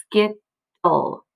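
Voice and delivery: "skittle" as "skit", pause, "tull"; "skittle" sounded out in two separate pieces; split into syllables. Shown as a demonstration. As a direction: break "skittle" in two with a clear gap. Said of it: "here we have another one".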